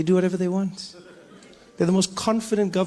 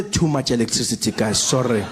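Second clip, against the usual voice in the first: mock apologetic official; 9 semitones lower than usual. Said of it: "Eskom would say".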